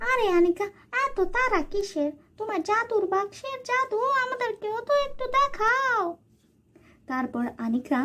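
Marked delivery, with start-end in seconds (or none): 0.1-6.0 high-pitched sweet-talking aunt; high, sly aunt welcoming her niece. Acted. Aunt teases Anika.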